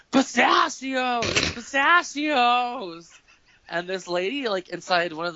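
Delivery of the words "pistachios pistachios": high, slow drawn-out shout; screamed pistachios. Retold or imitated. Roommate screams at pistachio bag in grocery, drawn-out high yell. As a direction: yell the word across the store like a goofy kid. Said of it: "he, like, screams".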